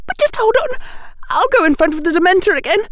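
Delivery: put-on very high, rushed girl's voice; urgent, high-pitched desperation; voice cracking. A narrator's reading. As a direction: blurt it out high, close to breaking. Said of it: "her voice cracking".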